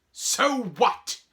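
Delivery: loud, high, defiant shout. Acted. Boy dismissing friend's warning, defiant shout. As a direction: throw it back at full volume. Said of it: "Harry shouted".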